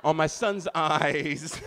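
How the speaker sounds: cracking up mid-oath; laughing. Real, not acted. Comic swearing an oath on his son's eyes, laughing.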